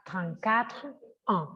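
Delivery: trente-quatre ans split apart, slow; said slowly. Shown as a demonstration. Teacher showing the slow, unlinked way. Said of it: "Si yo lo dijera despacio".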